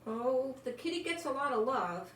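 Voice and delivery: plain reading voice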